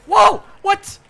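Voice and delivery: high, loud yelps of disbelief; shocked shouting. Real, not acted. Gamer caught in a trap, shrieking high and loud. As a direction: yell out in stunned disbelief. Caught by a trapper mid-game.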